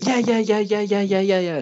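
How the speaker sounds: high, rapid excited yeahs, easing down; a toddler bouncing with yes; eager toddler. Retold or imitated. Son agreeing to the unicorn flavour, excited.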